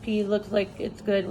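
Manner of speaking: normal talking voice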